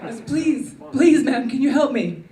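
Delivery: lightly voiced needy beggar; mock pleading beggar. Begging a woman for help.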